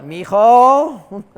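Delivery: loud, high mock mother calling her son; scolding mom. Mother turning her son in to police, loud high mock call. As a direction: call him out like a disappointed Mexican mom.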